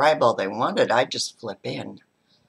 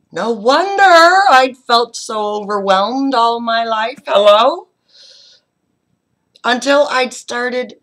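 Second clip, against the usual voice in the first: much louder, higher burst; exclaimed realisation; 10 semitones higher, 13 dB louder than usual. Real, not acted. To the audience, realising why life overwhelmed her, exclaimed loudly. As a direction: cry it out like it finally clicked.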